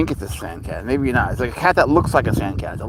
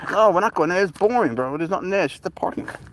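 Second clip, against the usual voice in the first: raised sing-song voice to the cat; high pet talk; 7 semitones higher than usual. Real, not acted. Owner coaxes his cat not to wander off, in high pet talk.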